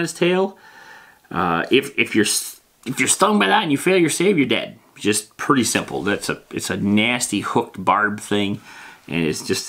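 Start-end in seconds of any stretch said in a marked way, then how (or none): none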